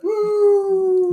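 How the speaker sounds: loud, very high sustained "woo"; imitated explosion noise. Host insisting to co-host on what was heard, imitated high whoop. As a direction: whoop out the sound you heard, long and high. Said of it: "I hear, like".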